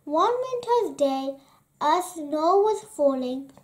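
plain reading voice

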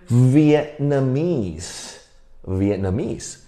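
Vietnamese said slowly, syllable by syllable; slow pronunciation demo. Shown as a demonstration. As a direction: model Vietnamese slowly for repeating. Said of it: "来，我们读一次". (慢速示范 Vietnamese 的读音)

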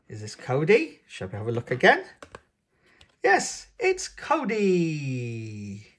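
plain reading voice

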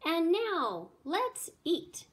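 excited announcement. A narrator's reading. Lady clapping to start the feast, excited announcement. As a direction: call everyone to the table.

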